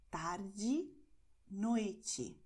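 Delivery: tarde and noite ending in a clear "ee"; final E as I. Shown as a demonstration. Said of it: "letter E at the end".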